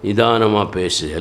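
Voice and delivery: deliberate, unhurried and low; measured counsel. Retold or imitated. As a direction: quote the apostle's advice, drawing out each word. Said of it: "Pavul சொல்றார்".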